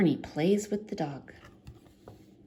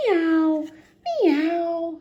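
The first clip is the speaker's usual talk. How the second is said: higher, louder meowing; cat meow; 10 semitones higher, 7 dB louder than usual. A narrator's reading. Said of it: "says the cat".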